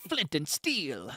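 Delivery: slow, drawn-out item announcement; item fanfare voice. Pretend. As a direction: announce it like a game item reveal. Item pickup announcement in game.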